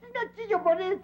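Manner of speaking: high-pitched tearful protest; crying. Acted. Child answering why he is crying, tearful and high. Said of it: "por qué chillas".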